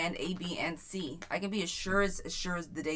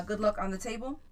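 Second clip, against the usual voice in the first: ordinary talk, nothing marked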